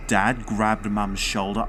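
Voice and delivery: ordinary delivery, nothing marked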